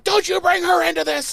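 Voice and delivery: husband shouting at wife over Buffy; furious shout. Retold or imitated. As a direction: snap at her to stop. Husband snaps in argument.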